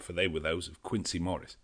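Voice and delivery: ordinary delivery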